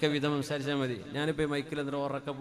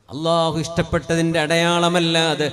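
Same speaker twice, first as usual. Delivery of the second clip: preaching at full force; 3 semitones higher, 10 dB louder, slower than usual. Acted. Preacher to congregation on God's love for a home, loud preaching. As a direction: proclaim it, stretching the words.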